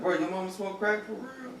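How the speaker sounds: high, earnest mock-serious ask, not joking at all; straight-faced, innocent question about his mother; dead serious question. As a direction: ask it like you truly want to know. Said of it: "I was dead serious".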